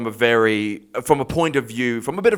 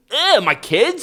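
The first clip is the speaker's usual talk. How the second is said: mock disgust; 12 semitones higher, much slower than usual. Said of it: "you're sort of like".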